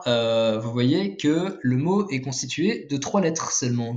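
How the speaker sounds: normal talking voice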